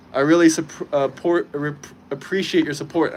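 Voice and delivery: tripping and restarting on the words; real stuttering. Real, not acted. Streamer thanking a supporter for support, stuttering. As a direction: stumble over half-words, then get it out. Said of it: "I'm stuttering like old Joe Biden again".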